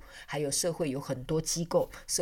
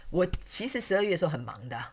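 usual speaking voice

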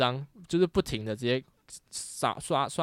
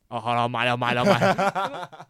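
slow, louder, drawn-out surrender; resigned giving in; 8 dB louder, slower than usual. Retold or imitated. Caving in to the group buying tickets, slow resigned drawl. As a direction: drag each word like giving up.

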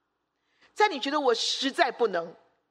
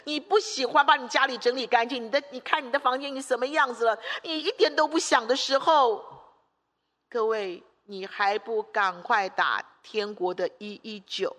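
ordinary talk

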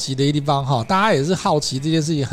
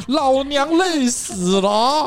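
exhausted groan; 10 semitones higher, much slower than usual. Retold or imitated. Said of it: "就说".

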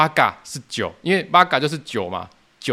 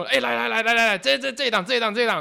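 eager calling over; 9 semitones higher than usual. Retold or imitated. Customer calls friends over.

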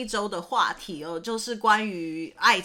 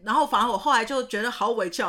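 normal talking voice

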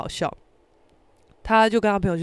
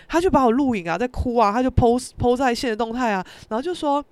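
normal talking voice

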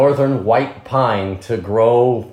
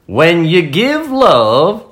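put-on squeaky high little girl penguin; high-pitched cute cartoon penguin girl; 6 dB louder, much slower than usual. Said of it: "your girl penguin voice".

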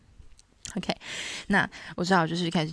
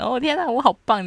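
higher, louder burst of pride; proud delight; 7 semitones higher, 6 dB louder than usual. Retold or imitated. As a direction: crow with delight. Feeling of accomplishment.

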